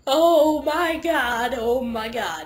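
slow, stretched mock dismay, starting high and loud; drawn-out reaction. Dreamer reacting to attacking fangirls, long drawn-out exclamation. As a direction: drag out each word, sinking back down. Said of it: "I'm just like".

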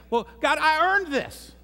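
high, slow mock protest "I earned this"; high, slow, half-joking self-justification; arguing with God. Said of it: "I say".

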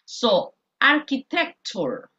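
slow, careful architecture, every syllable clear; teacher modelling the word architecture slowly; model pronunciation. Shown as a demonstration. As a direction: say architecture slowly for the class to repeat. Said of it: "I'm going to read it for you".

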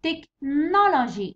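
Technology slowly, with 'no' punched; stress on NO. Shown as a demonstration. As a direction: break after 'tech' and lean hard on 'no'. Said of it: "تحط على نو".

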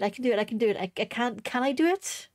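normal talking voice

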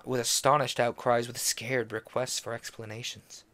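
usual speaking voice

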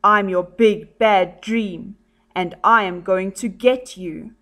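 ordinary talk, nothing marked

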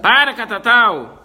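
loud scolding call; sharp shout. Real, not acted. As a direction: snap the dog out of it. Dog fight again.

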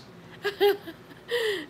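high, bright laugh of delight. Real, not acted. Thrilled to see Holly Posh after ages, a high laugh. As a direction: burst out laughing with joy.

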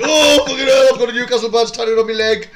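high, loud mock wail of dismay; mocking newcomer fan. Host mocks fans new since the takeover, high mock wail. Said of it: "and then you're like".

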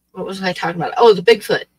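ordinary talk, nothing marked

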